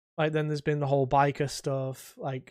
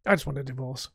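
flustered character rant, pitched up at first; ex-husband Harold; 3 semitones higher than usual. Shown as a demonstration. Gamer rehearses fed-up ex-husband wanting divorce, flustered grumble. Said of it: "I've gotta get, like, that".

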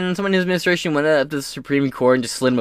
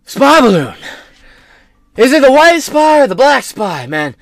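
put-on much higher and louder, confused old man; mock rambling Biden; 8 semitones higher, 8 dB louder than usual. Biden answering the governor as a confused rambling old man. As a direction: ham up a doddering president.

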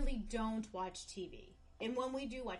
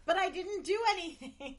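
high, loud protesting holler; mock yell; 9 semitones higher, 7 dB louder than usual. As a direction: imitate him yelling back in protest. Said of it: "And then he'd yell".

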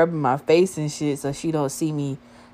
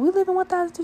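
put-on high girly voice, higher; imitating a girl; faster than usual. One girl telling her friend he lives there, high girly voice. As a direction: pitch up into a chatty girl's voice. Friend quoted at front desk.

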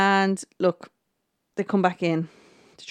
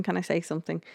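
same plea again, much softer; softer repeat; 8 dB quieter, faster than usual. Retold or imitated. Harry repeating his plea to Lord Sugar, trailing softer. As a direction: let the repeat fall away quietly. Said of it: "Harry keeps on saying".

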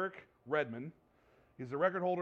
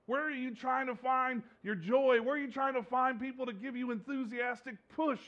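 high, loud rising sermon peak; preaching at full force; 7 semitones higher, 5 dB louder than usual. Acted. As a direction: drive the message home to the congregation. Preaching climax of the story.

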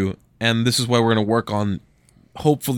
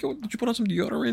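soft, high, gently polite question; mock-polite, tiptoe round an awkward question; tactful; 12 semitones higher, 8 dB quieter, faster than usual. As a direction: ask it delicately, high and hushed. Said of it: "I'm gonna be tactful".

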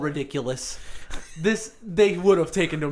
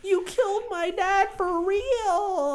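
high, slow mock whine; mock dramatic; slower than usual. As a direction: ham up a tragic wail. Mocking a movie line.

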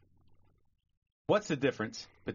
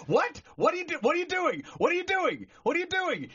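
high, loud panicked father; panicked yell; 3 dB louder than usual. Acted. Horrified father to doctor who dropped baby, panicked yell. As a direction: shriek it in horror at the doctor.

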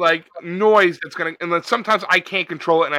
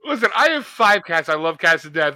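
usual speaking voice

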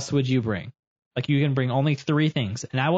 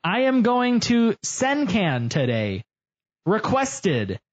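mock reading; 7 semitones higher, much slower than usual. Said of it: "It says".

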